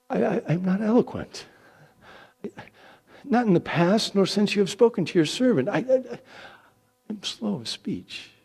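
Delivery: a frightened man pleading his case; panicked stammer. Acted. Moses pleads he cannot speak.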